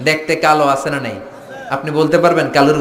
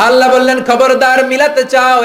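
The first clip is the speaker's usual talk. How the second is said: high, loud preacher's cry; raised, urgent sermon voice; thundering warning; 9 semitones higher, 5 dB louder than usual. Real, not acted. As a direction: proclaim it forcefully to the crowd. Preacher cries out God's warning.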